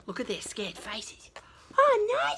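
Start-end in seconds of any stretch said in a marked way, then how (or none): 1.8-2.4 high, loud "oh no" of alarm; a high alarmed wail; frightened cry. Acted. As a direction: squeal it out in fright. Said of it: "said Mama Sa".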